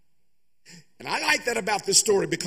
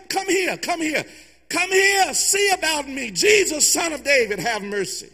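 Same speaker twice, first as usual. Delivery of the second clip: desperate calling out; 7 semitones higher than usual. Acted. Blind beggar calling Jesus over, desperate and high. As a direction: beg him to come over.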